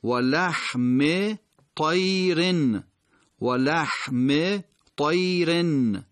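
و لحم طیرن slowly, "wa-laḥmi ṭayrin"; short i on لحم, held -n on طیرن; tanwin contrast. Shown as a demonstration. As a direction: recite و لحم طیرن high, word by word. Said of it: "یکیش تنوین داره یکیش نداره".

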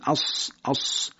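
sharp sustained "اس" with a whistling s; hissing s. Shown as a demonstration. Teacher demonstrating a whistled s sound. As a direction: let the s of "اس" whistle briefly.

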